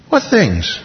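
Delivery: innocent mock question; playful feigned ignorance. Jesus teases the disciples with a playful innocent question. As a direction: ask it as if you know nothing. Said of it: "there's divine humor".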